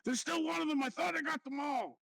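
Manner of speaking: high-pitched yelled alarm; in-character shout. Acted. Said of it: "uh, shout".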